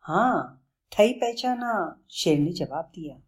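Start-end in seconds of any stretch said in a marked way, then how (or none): 0.0-2.0 slow childish lisp, lightly voiced lion. Lion admits his lisp to Bablu, slow. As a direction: drawl it out like a proud lisping lion.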